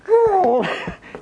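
loud, high mock groan of effort; long exaggerated exertion growl; acted straining groan. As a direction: strain like pulling a heavy load. Acting out straining to go faster.

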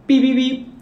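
high, loud electronic beeps; thermometer beeps. Retold or imitated. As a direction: imitate a beeping thermometer loudly, high up.

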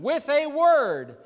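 slow, high "with a word"; emphatic preaching. Real, not acted. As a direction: stretch "with a word" out, pitched well up. Preacher repeats phrase for emphasis.

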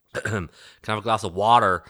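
mock American ordering water; imitating an American accent to be understood; put-on American accent. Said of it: "So I was like".

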